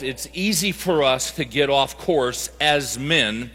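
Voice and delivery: ordinary talk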